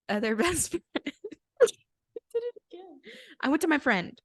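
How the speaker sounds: high, loud, helpless laughter in the words; laughing at slip. Real, not acted. Speaker tripping over "best friend" again, laughing. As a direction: crack up while talking.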